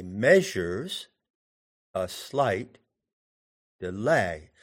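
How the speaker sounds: slow, measured "measures ... a slight ... delay", phrase by phrase; metrical stress demo. Shown as a demonstration. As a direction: separate the beats: MEA-sures, a SLIGHT, de-LAY. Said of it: "the word delay is iambic".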